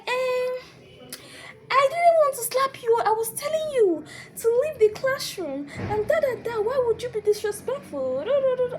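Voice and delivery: very high, loud, whining self-justification; hammed-up high-pitched protest trailing into babble; mocking lecturer imitation. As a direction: mimic someone wailing excuses, stretched out.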